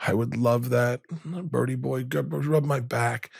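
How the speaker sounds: a hint of an old grandma asking sweetly; grandma's reply. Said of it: "she goes".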